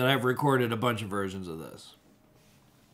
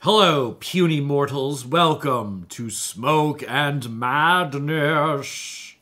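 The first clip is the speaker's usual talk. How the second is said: villain voice; 2 semitones higher, 5 dB louder, much slower than usual. Pretend. Theatrical villain intro for show.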